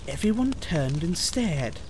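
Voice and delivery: ordinary delivery, nothing marked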